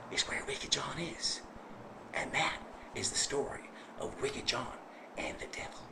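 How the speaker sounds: soft, hushed ending; closing the ghost story in a whisper; spooky whisper. A narrator's reading. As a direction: let the last words fade.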